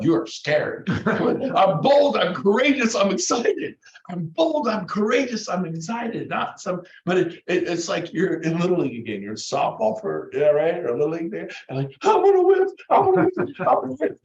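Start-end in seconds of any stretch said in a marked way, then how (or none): 1.1-6.3 high, emphatic, pumped-up declaration; bold affirmation. Shown as a demonstration. Coach models confident self-talk to replace fear, pumped up. 12.0-14.2 very high, loud squeaky-pitched chant; hammed-up kid psyching himself up; Little League kid. Coach imitates a kid at Little League, loud high chanting.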